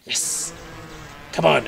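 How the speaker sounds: ordinary delivery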